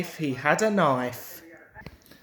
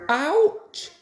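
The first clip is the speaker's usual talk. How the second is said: high, louder sudden yelp; sudden ouch; 10 semitones higher, 5 dB louder than usual. A narrator's reading. Peter Sam caught on brambles, high sudden ouch. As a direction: cry out as the thorns catch. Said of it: "exclaimed Peter Sam suddenly".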